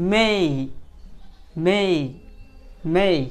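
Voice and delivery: "may" with a stretched ay glide; drilled "ey" sound. Shown as a demonstration. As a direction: repeat "may" as a slow model for learners. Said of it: "আপনি যদি এই বলে থাকেন".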